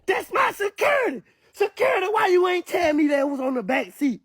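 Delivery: high, strained mock shout of [name]. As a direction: squeal it out in a frantic put-on voice. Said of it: "[name] said".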